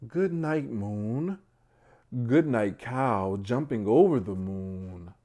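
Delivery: drawing out each rhyming line; bedtime story reading. Acted. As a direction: read it out like a picture book for little ones. Reads bedtime story aloud.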